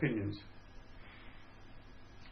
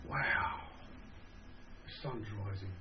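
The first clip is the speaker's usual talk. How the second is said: breathless hushed whisper, much softer; awed whisper; 4 semitones lower, 9 dB quieter than usual. Retold or imitated. Sailor on the flight deck whispering in awe at dawn. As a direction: whisper it, struck by the sunrise over the sea. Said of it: "I stood and looked in awe".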